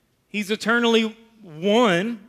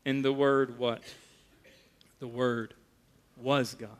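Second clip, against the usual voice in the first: hushed emphasis; 7 semitones lower, 8 dB quieter than usual. Real, not acted. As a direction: let it land softly, word by word. Pastor slowly builds to key phrase.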